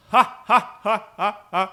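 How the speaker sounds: loud, high evil cackle; evil villain laugh. Retold or imitated. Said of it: "this evil villain laugh".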